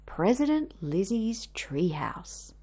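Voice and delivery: whispered daydream; soft, wistful whisper; dreamy whisper. Acted. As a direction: hush it like a secret wish. Lizzie daydreams aloud.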